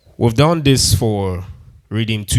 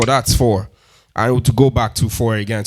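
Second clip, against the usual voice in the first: usual speaking voice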